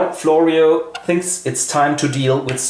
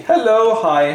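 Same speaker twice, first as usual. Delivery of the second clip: mock coy niece; 8 semitones higher, 4 dB louder than usual. Lute-playing niece greets.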